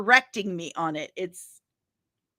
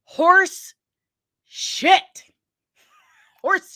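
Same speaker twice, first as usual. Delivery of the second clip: high loud drawn out disbelief; indignant high cry; outraged exclamation; 8 semitones higher, 6 dB louder, much slower than usual. Real, not acted. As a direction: blurt it out in outrage. Outraged reaction to quote.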